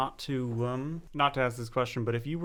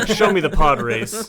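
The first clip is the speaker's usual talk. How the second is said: loud, slow ad-slogan demand; mock Carfax demand; 2 semitones higher, 11 dB louder, slower than usual. Imitating friend asking for Carfax, loud mock demand. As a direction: laughingly belt it out like a catchphrase. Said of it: "like you're asking for the Carfax".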